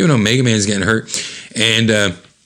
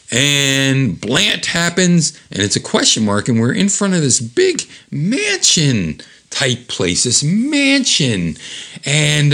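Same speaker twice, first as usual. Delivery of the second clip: plain reading voice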